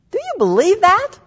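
loud, high-pitched insistent question; emphatic challenge. Real, not acted. Preacher repeating her question to the congregation, loud emphatic challenge.

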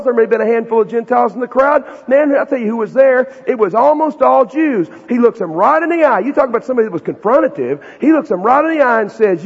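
ordinary talk

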